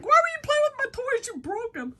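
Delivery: high, mock-indignant whine; hammed-up outraged squeal, easing at the end; mad son. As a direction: protest like someone whose toys were messed with. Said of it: "he's gonna be so mad".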